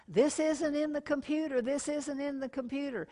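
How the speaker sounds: high-pitched mock yell across the store; retold yell. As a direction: call it out high like a frazzled cashier. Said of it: "she yelled over at him".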